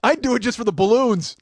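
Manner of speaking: loud, emphatic comic confession; mock-indignant outburst. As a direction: belt out the absurd plan.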